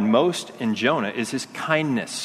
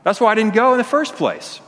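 petulant Jonah; 6 semitones higher than usual. Retold or imitated. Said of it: "And he's like".

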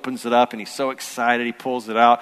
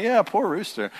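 high-pitched playful pity; imitated dad's story-time sympathy; mock sympathy; 9 semitones higher than usual. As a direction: commiserate with the kids, hammed up. Dad joins kids' pity in retelling.

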